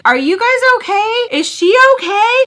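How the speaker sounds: high, loud imitated bystander; concerned stranger. People in the other stall checking on girls, high loud concern.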